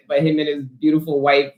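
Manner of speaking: usual speaking voice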